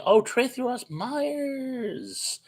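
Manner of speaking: drawn-out high delighted greeting; long, high happy call to a viewer; excited blurt. Real, not acted. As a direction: blurt her name out with joy. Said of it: "what I was doing when I blurted that out".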